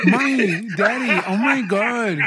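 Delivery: high, slow, overjoyed squeal at the in-laws; gushing delight. Retold or imitated. Son-in-law gushing to in-laws over gifts, high delighted squeal. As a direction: gush like a spoiled kid getting presents. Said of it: "I'd be like this".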